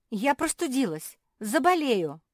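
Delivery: high, loud plaintive protest; squealing. A narrator's reading. Marsia telling Malum she caught cold, squealing. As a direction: squeal as if gravely wronged. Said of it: "взвизгнула Марси и чихнула".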